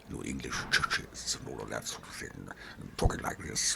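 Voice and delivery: imitating a stately TV presenter touring churches; Donald Sinden. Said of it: "Donald Sinden in English churches".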